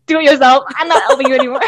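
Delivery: high, loud mock exasperation; imitating fed-up mom. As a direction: act out mom washing her hands of it. Mom refusing further homework help.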